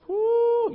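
high, loud joyful whoop. Real, not acted. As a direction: let out a happy church whoop. Joyful preacher shout.

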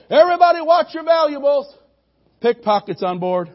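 loud, high bellow to the whole bus; bellowing bus driver. Retold or imitated. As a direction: bark the warning over the crowd.

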